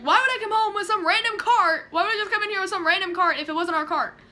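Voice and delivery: high, loud, fast indignant rant; imitated yelling. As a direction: imitate an outraged mom telling someone off. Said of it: "goes in and yells at her".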